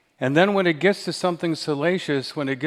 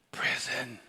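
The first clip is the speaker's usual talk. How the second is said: whisper; 10 dB quieter than usual. Retold or imitated. Young man whispering his answer to the dinner host. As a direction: drop it to a near-silent hush. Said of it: "he whispers the word prison".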